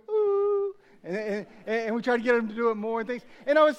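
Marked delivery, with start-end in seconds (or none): 0.1-0.8 high mock coo at a baby; cooing back. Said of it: "we go".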